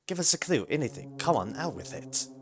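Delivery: pleading, high and impatient; annoyed and desperate. Acted. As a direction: push him for an answer, exasperated. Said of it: "more annoyed or more desperate".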